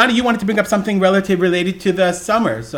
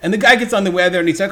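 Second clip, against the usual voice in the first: ordinary talk, nothing marked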